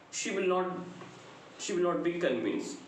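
ordinary delivery

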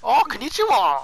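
lightly put-on Japanese accent; playful imitation of a Japanese accent; mock Japanese accent. Answering the other player's question, mock Japanese accent. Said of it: "what's her accent be Japanese".

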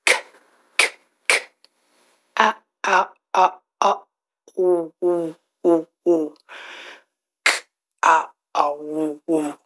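each letter sound stretched and repeated, K, A, U. Shown as a demonstration. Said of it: "let's sound it out".

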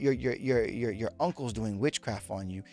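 low, soft ominous psychic; hushed witch voice. Retold or imitated. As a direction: drop low and hush it, ominous. Witch voiced warning client.

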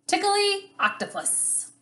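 title in a very high, playful voice; high title call. Shown as a demonstration. Teacher announces the cute book's title to children, high and playful. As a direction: lift the whole title way up high.

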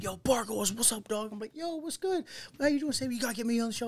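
high-pitched mock hype; excited club greeting. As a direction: re-enact an eager hello on a night out. Re-enacting a drunken greeting at a club.

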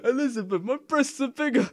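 very high, loud falsetto squeal; hammed-up high-pitched reading; squeaky mock voice. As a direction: shriek it like a cartoon girl. Reading absurd line in mock voice.

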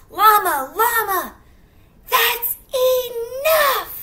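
high, loud, drawn-out cry of enough; exasperated mama shout. Acted. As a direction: snap the tantrum to a halt. Mama yells at llama.

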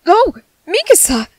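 high, sudden exclamation; startled greeting. Acted. Medic noticing comrade Mikasa arrive, startled greeting. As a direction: blurt the name out in surprise.

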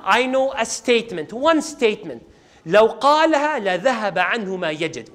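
ordinary delivery

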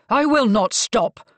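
loud, slow, defiant roar. A narrator's reading. Marcus refuses to be quietened, roaring. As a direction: roar each word in defiance. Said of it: "Marcus roared".

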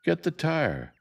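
high, loud holler. Acted. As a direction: bark the order across the street. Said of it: "Jem hollered".

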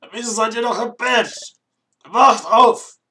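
high, booming wake-up shout; ridiculously loud voice. Acted. Apprentice yelling at the two still in bed, very loud. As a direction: yell them out of bed at full blast. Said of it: "lächerlich laute Stimme".

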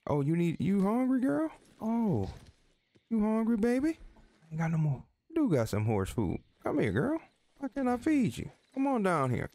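high coaxing pet voice. Real, not acted. Talking sweetly to his horse.

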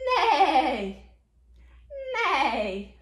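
loud, wavering whinny falling away; quavering drawn-out neigh; horse neigh. Acted. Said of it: "Like a horse".

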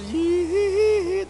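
high falsetto hee hee. Retold or imitated. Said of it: "after I said".

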